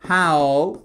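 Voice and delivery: 'How' alone, loud and drawn out; emphatic, isolated 'How'; careful pronunciation. Shown as a demonstration. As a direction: model the first part 'How' by itself. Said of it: "attention à la prononciation".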